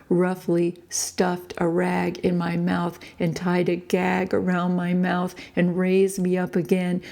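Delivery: usual speaking voice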